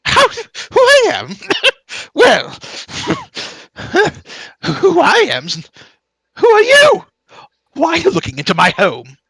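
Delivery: high, loud, swooping eccentric wizard; cackling, scandalized old mage; outraged mad mage. Acted. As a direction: splutter with theatrical offence. Game master voices offended mage.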